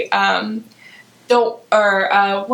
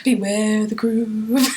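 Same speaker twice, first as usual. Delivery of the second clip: slow, spooky warning; playful cryptic caution; ominous movie warning; slower than usual. Retold or imitated. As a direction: draw it out like a mock omen.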